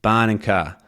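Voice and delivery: BARN and CAR with open AH and no R; non-rhotic, R dropped. Shown as a demonstration. Teacher trying another non-rhotic take, dropped R. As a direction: say BARN and CAR as "bahn", "cah". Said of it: "rhotic and non-rhotic dialects".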